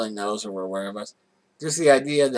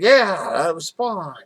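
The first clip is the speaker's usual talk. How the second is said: higher, louder, drawn-out cheer; excited cheer; 7 semitones higher, 9 dB louder, slower than usual. Retold or imitated. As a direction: celebrate the win, slowly. Retold cheering at win.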